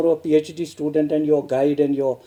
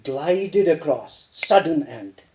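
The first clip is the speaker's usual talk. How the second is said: gliding then abrupt stop; 3 semitones lower, slower than usual. Shown as a demonstration. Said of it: "It's like a sunset".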